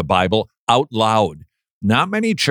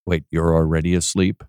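mock sleepy drone; 7 semitones lower than usual. Shown as a demonstration. Teasing the listeners who already dozed off, in a low drone. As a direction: keep the sleepy monotone going. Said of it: "fall asleep".

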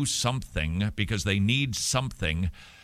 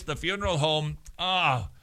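ordinary delivery

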